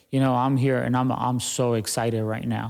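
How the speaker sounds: deadpan claim of being excited; unconvincing excitement. Shown as a demonstration. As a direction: say you're excited with no energy at all. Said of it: "tell that to your face".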